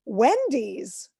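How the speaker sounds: ordinary delivery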